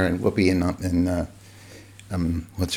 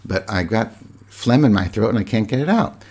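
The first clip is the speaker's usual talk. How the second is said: normal talking voice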